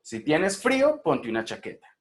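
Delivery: FRÍO pitched high at the comma, CHAQUETA falls; rise then fall. Shown as a demonstration. Teacher repeating a conditional for students, rise then fall. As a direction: climb up to "frío", come down on "ponte una chaqueta". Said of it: "sube y luego baja".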